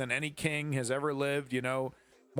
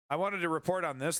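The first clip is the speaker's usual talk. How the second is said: normal talking voice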